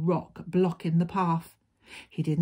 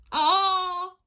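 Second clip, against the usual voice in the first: very high, loud "aah"; little wolf's cry; 13 semitones higher, 6 dB louder than usual. A narrator's reading. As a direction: yelp out as the tumbling wolf. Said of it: "cried Little Wolf".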